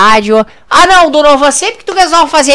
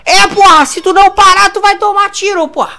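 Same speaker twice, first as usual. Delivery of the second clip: higher, barked mock threat; mock tough threat; 7 semitones higher than usual. [name] threatens Donovan with a mock bark. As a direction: threaten like a cartoon thug.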